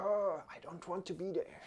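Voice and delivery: whispered high voice of a reluctant foot; foot's voice. Pretend. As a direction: voice the foot that wants to leave. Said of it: "it feels like".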